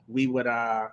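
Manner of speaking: ordinary talk, nothing marked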